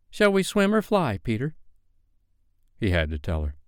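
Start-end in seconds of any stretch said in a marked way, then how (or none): 0.1-1.6 put-on high-pitched girlish question; Wendy's voice. A narrator's reading. Wendy asking Peter how they will leave, high girlish voice. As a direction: ask innocently in a high girl's voice.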